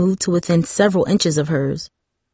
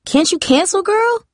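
sassy friend voice; 13 semitones higher than usual. A narrator's reading. Tara voiced, much higher.

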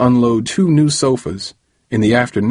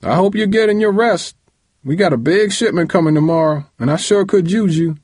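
yelling; 10 semitones higher than usual. A narrator's reading. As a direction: yell it up the stairs, much higher. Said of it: "Uncle James yelled".

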